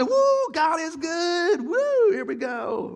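very high, slow whooping cheer; hammed-up celebration. Celebrating when the car starts, loud high whooping. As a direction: whoop and dance like a celebrating fan. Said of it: "Sorry for that dance".

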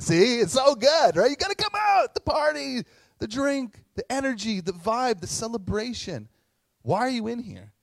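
excited father. Retold or imitated. Father urging his oldest son to join the party, excited and high. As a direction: pull him out to the party with big energy.

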